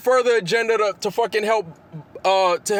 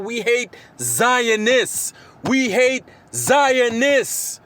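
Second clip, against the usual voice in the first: very slow, every word pressed hard; correcting his critics, he spells it out word by word; slow emphatic insistence; 3 semitones higher, much slower than usual. Real, not acted. Answering his accusers, slowly hammers each word.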